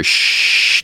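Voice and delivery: unvoiced shh of dead air; radio static hiss. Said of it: "I just hear".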